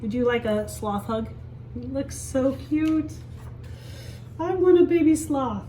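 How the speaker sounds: ordinary talk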